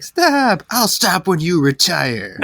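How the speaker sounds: high cry, then drawn-out comeback; mock exasperated retort. Prank-war victim and prankster arguing, mock-exasperated. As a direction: shout the plea, then stretch the answer.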